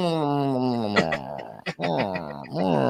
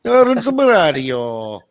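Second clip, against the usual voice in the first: slow, loud, higher cartoon Mario; 5 semitones higher, 7 dB louder, much slower than usual. Copying his friend's Mario filter bit in a loud, slow Mario voice. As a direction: stretch it out like a cartoon plumber. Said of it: "play like that".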